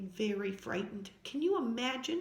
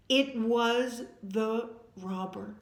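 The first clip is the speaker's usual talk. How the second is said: usual speaking voice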